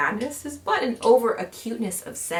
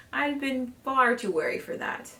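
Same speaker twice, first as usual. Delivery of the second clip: ordinary delivery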